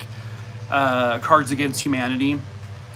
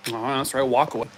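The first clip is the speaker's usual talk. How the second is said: ordinary delivery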